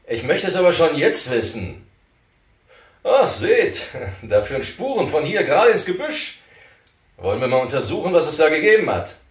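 pushing to go look at the tracks; curious companion. Acted. Companion urging the others toward the bushes, eager.

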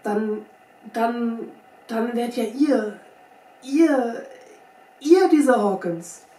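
faltering, then a loud amazed finish; dawning astonishment. A narrator's reading. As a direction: stumble toward the realization, astonished. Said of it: "indem seine Augen sich erweiterten".

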